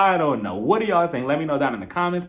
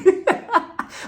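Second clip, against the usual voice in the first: high incredulous laugh; 3 dB louder than usual. Real, not acted. Vlogger reacting to Margaret's story, high laugh. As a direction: laugh in disbelief.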